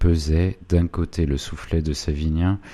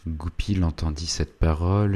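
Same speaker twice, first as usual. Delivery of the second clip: usual speaking voice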